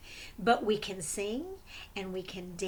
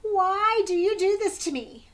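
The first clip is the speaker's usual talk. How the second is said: higher and louder protest; wronged tiger yelling at turtle; indignant tiger; 8 semitones higher, 7 dB louder than usual. Acted. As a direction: demand an answer, hurt and angry. Angry tiger confronts turtle.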